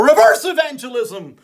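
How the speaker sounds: normal talking voice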